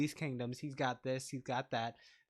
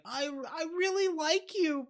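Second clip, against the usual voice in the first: mock timid confession; 13 semitones higher, 5 dB louder than usual. Mock lame crush confession.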